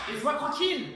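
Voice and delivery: loud, furious shout; angry yell. Acted. Teen yelling at her mother to be left alone, angry.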